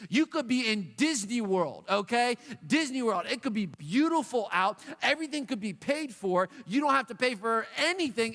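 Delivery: ordinary talk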